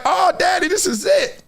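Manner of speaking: put-on high, shouting little boy's voice; high excited child asking his dad; excited kid son. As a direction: squeal it like a thrilled young son. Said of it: "my son's like".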